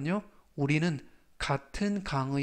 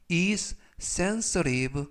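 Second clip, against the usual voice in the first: slow model reading; much slower than usual. Shown as a demonstration. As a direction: say it slowly for learners. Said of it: "활용 문장 보시겠습니다". (천천히 또박또박 읽는 예문)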